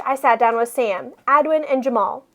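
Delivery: usual speaking voice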